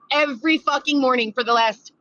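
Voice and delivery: ordinary talk, nothing marked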